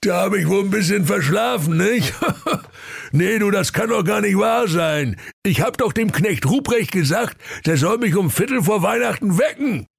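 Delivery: put-on high chuckling old Santa voice; sheepish Santa. Santa caught oversleeping, high sheepish chuckle.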